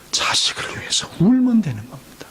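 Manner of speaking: hushed earnest whisper. Real, not acted. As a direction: drop to a whisper to press it home. Preacher's closing exhortation.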